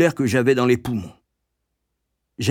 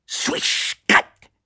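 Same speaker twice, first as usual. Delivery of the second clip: cane sound effect. A narrator's reading. Cane coming down on the boy, onomatopoeic. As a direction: imitate a cane whistling and striking. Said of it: "fit la canne".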